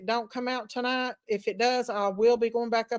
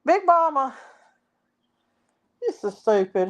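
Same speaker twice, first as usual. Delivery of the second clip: usual speaking voice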